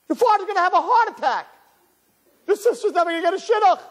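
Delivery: very high, melodramatic panicked rant; hysterical parent. Acted. Parent reacting to kid's fifty, hysterical rant. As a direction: wail it like the family is doomed.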